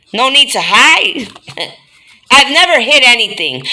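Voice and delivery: normal talking voice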